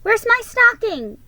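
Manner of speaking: high, loud excited asking; eager. Acted. Boy looking for his stocking, high excited question. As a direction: ask it bursting with eagerness. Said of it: "he cried".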